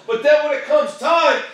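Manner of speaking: high, loud exasperated rant. Real, not acted. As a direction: call them out at full voice. Ranting about absent big talkers.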